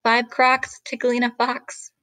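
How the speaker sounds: usual speaking voice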